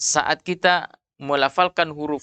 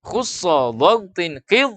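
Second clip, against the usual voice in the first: slow, higher-pitched Arabic syllables; slow mnemonic recitation; 4 semitones higher, 4 dB louder, slower than usual. Shown as a demonstration. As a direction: chant out the mnemonic slowly for students. Repeating the mnemonic for students.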